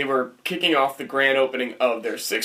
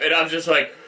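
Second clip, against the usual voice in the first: normal talking voice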